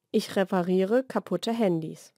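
usual speaking voice

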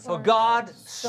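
For God so, each word slow and leaned on; deliberate teacher's emphasis on For God so; emphasize the opening words. Shown as a demonstration. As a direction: lean hard on For God so, slowly. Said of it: "Let's emphasize the first three words".

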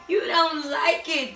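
ordinary delivery

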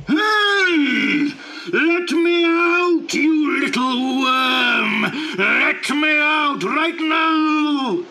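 high, slow, loud shouting; trapped genie yelling. Acted. Trapped genie yelling at Abdullah to let him out. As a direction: bellow from inside the bottle.

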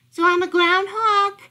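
high, loud puzzled question; a bewildered puppy; confused dog. Acted. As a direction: guess hopefully, all mixed up.

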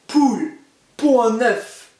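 the giant ordering his hen to lay; ogre's order. Acted. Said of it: "ordonna-t-il".

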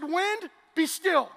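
very slow, loud command; commanding Jesus. Acted. Jesus speaking to the storm, slow loud command. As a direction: order the wind to stop.

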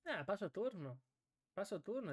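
ordinary delivery

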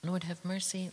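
ordinary delivery

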